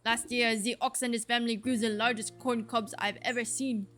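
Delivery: put-on grumbling farmer in a rough French accent; hammy French-accented farmer. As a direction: complain with an attempted French accent. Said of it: "is this a French accent?".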